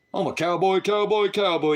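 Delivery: high singsong mockery of a boast; mocking imitation. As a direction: parrot him in a high, taunting voice. Mocks the other man's boast.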